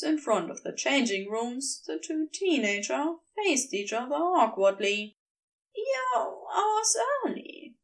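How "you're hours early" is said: usual speaking voice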